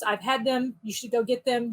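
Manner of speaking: ordinary talk, nothing marked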